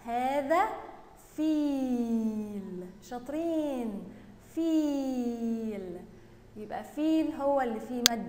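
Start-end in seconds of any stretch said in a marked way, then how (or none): 1.4-2.9 very long "ee" held in "فيل"; drawn-out word. Shown as a demonstration. Teacher stresses the long vowel. 4.6-6.0 very long "ee" held in "فيل"; drawn-out word. Shown as a demonstration. Teacher stresses the long vowel.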